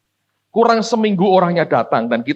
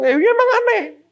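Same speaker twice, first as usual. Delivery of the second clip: laughing high. Real, not acted. Laughing retort about being odd.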